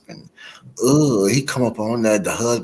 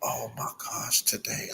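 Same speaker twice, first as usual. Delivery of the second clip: 1 whisper; 15 dB quieter than usual. Real, not acted. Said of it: "I'm scared for my life".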